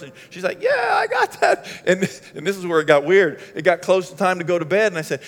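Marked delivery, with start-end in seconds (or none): 0.6-1.7 2 very high, slow, loud squeal; 3 stretch it out way up high like the wife; 1 mock wife voice. 4 wife agreeing to feed the guest, hammed-up high squeal.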